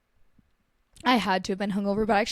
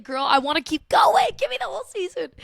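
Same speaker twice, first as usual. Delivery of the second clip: mock excited plea; 7 semitones higher, 6 dB louder than usual. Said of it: "It's like".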